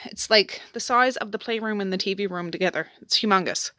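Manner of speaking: ordinary delivery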